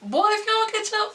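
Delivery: high, laughing mock threat; threatening sass. To boyfriend calling her name, laughing sassy warning. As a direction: warn him off with playful sass. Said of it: "I'm like".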